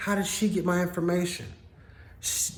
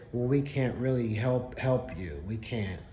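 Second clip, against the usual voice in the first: much lower and softer, a limp official shrug; mock police brush-off; 5 semitones lower, 5 dB quieter than usual. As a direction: fade it out like a useless cop. Imitating police brushing him off.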